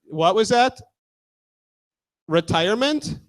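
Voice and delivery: ordinary talk, nothing marked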